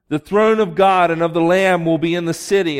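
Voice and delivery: usual speaking voice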